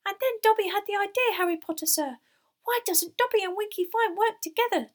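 high, loud elf shout; excited squeaky elf, straining to be heard; shrill shouting. A narrator's reading. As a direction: shout shrilly over a din. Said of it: "shouting shrilly over Winky's screeches".

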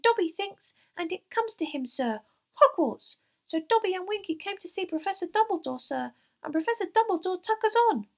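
high, loud, excited elf; piercing, eager elf storytelling; shrill shouting. A narrator's reading. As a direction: tell it shrilly, building to a triumph. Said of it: "shouting shrilly over Winky's screeches".

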